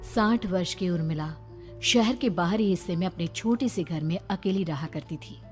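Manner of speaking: ordinary delivery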